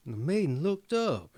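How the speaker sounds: ordinary delivery, nothing marked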